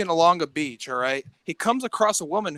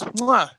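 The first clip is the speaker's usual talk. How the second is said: exaggerated smooch "mwah"; playful mock smooch; big kiss noise; 2 semitones higher than usual. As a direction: plant a big pretend kiss. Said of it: "gives her a big kiss".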